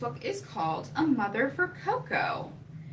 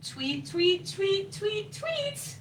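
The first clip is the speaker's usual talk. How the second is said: rising, ever higher chirps; baby bird tweet; 7 semitones higher, slower than usual. Acted. Baby bird chirping for mommy, rising high tweets. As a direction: climb up the chirps like a chick. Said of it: "said baby bird number".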